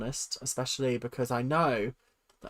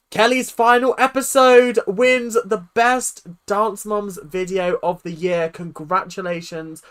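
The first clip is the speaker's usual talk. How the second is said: loud, slow, raised announcer voice; winner announcement; 4 semitones higher, 11 dB louder than usual. Acted. Streamer announcing the poll winner, big award-show voice. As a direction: proclaim the winner to a crowd.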